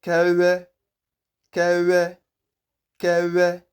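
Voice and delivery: Kewe high and level, w squeezed through the lips; correct vw pronunciation. Shown as a demonstration. Teacher modelling a name, correct vw pronunciation. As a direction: Kewe with a buzzing lip sound for w. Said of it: "and it is pronounced as".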